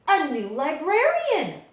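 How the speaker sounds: storyteller's big reveal; dramatic reveal. A narrator's reading. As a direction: build it up like a big surprise. Narrator reveals the new librarian.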